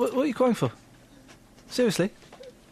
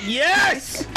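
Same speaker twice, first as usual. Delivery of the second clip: triumphant cheer; 8 semitones higher than usual. Real, not acted. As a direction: yell it like a winning goal. Cheering the travel news reader.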